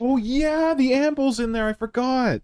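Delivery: high, bright oh yeah; suddenly remembering, delighted; excited realisation. Real, not acted.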